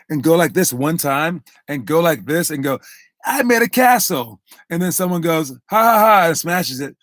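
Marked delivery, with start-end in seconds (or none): 3.2-4.4 high-pitched mock boast; hammed-up, high, childlike pride; proud little kid imitation. Mimics a child's half-effort boast. 5.5-6.3 loud, high fake "ha ha ha"; hammed-up gloating laugh; mock taunting laugh. Imitates someone smashing the castle.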